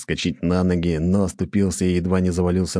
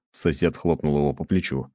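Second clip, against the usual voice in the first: usual speaking voice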